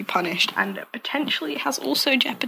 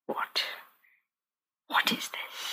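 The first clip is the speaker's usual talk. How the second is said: whispered confusion; 10 dB quieter than usual. Retold or imitated. Confused at the recording device.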